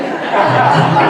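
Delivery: sudden loud laugh at her own joke; real laugh cutting into her speech; loud burst of laughter. Real, not acted. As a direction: break into a big laugh. Crowd laughing at pool gossip line.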